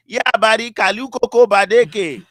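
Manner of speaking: high loud playful gibberish; made-up gibberish. Retold or imitated. Joseph babbling back at JT, playful gibberish. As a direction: answer with nonsense sounds, having fun. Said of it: "just made it up as you went".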